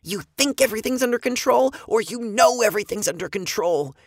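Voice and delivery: high, tense, quick challenge; hissed. A narrator's reading. Girl challenging her brother's word, tense high hiss. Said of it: "Eva hissed".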